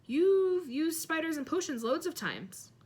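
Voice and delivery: a touch of disbelief; surprised. A narrator's reading. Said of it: "looking at Ron in surprise".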